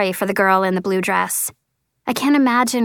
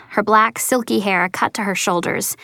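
plain reading voice

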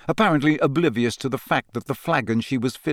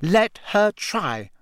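slow, higher defiant challenge; defiantly; 9 semitones higher, slower than usual. A narrator's reading. Hermione defying Rita while walking off. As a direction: dare her to come. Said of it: "said Hermione defiantly".

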